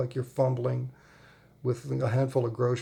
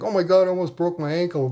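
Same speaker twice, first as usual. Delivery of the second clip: quoting her daughter, higher and louder, startled; startled exclamation; 5 semitones higher, 5 dB louder than usual. Retold or imitated. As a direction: blurt it out as if you just nearly fell. Daughter stepping out of low minivan.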